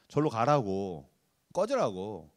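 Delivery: slow, low dismissal; mock eldest brother. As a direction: drag it out with contempt. (막내를 쫓아내는 큰형, 낮고 느리게)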